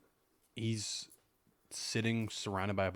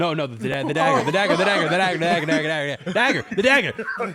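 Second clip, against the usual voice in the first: louder and higher, building into laughter; insisting ever louder until laughing; exasperated repeating; 9 semitones higher, 14 dB louder than usual. Real, not acted. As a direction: hammer the word over and over, cracking up.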